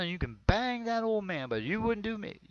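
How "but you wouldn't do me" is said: ordinary talk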